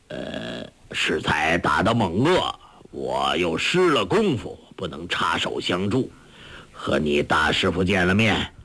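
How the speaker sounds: low, reassuring elder's voice; old beggar master. Acted. Old beggar master reassures his disciple about his teacher, low and earnest.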